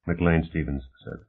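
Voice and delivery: ordinary delivery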